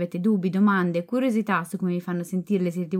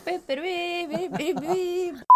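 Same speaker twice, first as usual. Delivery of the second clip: trumpet imitation; 11 semitones higher, 4 dB quieter, much slower than usual. Trumpet sounds after trumpet nose.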